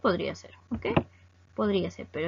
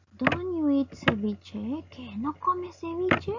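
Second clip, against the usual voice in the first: slow, high-pitched question with a rising end; demonstrated disbelief, slow and high; surprised question; 6 semitones higher, much slower than usual. Shown as a demonstration. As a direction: act surprised, drawing the question out. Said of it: "lo que nosotros expresamos es sorpresa".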